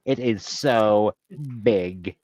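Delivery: slow, drawn-out mock Valley Girl; Valley Girl accent. Girl gossiping to Becky, slow Valley Girl accent. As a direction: drag out each word like a Valley Girl.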